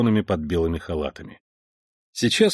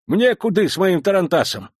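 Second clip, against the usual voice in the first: put-on cheeky old man voice; 9 semitones higher, 3 dB louder than usual. Legless old man rolls up.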